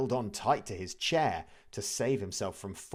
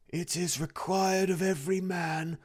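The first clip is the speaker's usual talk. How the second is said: ghost voice; 10 semitones higher than usual. A narrator's reading. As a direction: intone it like a wailing spirit. Ghost speaking.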